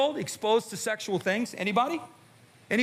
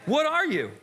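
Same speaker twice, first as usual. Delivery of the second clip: challenging call; 7 semitones higher than usual. Real, not acted. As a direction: throw the question at them. Challenging the congregation.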